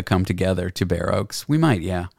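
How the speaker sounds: ordinary delivery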